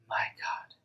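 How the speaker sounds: breathless whisper of awe; awed whisper. Acted. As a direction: whisper it as you kneel. Thomas falls before Jesus.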